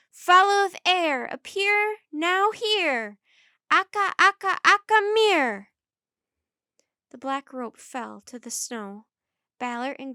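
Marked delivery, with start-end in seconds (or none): 0.1-5.7 high, slow, booming incantation; loud shouted spell. A narrator's reading. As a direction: shout it out like a magic command. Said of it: "In a loud voice, she shouted".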